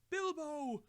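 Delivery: calling out. A narrator's reading. Frodo bursting in searching for Bilbo, calling out. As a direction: call through the house for him.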